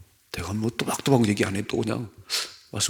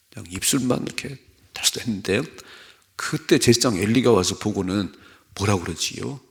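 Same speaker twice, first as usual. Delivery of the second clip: normal talking voice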